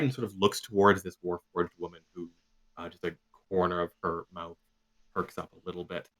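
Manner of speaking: usual speaking voice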